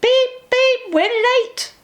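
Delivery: high, loud fussing beeps; fussy engine. A narrator's reading. Edward fussing that the train is late, high and loud. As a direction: fuss like a hurried little engine. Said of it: "fussed Edward".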